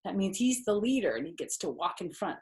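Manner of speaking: plain reading voice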